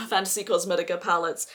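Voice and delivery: ordinary delivery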